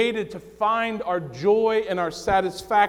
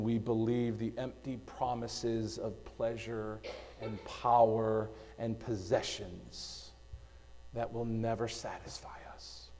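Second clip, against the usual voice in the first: hushed preaching; 6 semitones lower, 12 dB quieter than usual. Real, not acted. Preacher softly warning the congregation about empty idols. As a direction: let it sink to a sombre hush. Sermon drops to hush.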